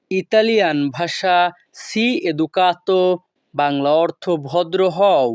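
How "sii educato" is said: "sii educato" drawn out slowly; slow repeat. Shown as a demonstration. Teacher repeating for students, slow and careful.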